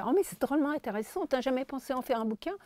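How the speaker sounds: high and rushed enthusiasm; fast high voice. Retold or imitated. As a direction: gush it fast in a piping voice. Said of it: "Elle avait une voix un peu aiguë". (voix aiguë rapide et enthousiaste)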